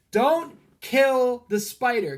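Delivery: drawn-out call to stop someone; shout. Retold or imitated. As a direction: shout it across the room at girlfriend Tara. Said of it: "I, I shout".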